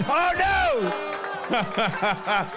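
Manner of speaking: high startled oh no; real surprise. Real, not acted. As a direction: yelp as the stand falls. Mic stand falls mid-sentence.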